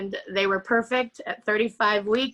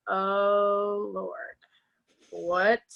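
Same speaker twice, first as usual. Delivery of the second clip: retold dismay; 2 semitones lower, much slower than usual. Retold dismay at pregnancy prediction.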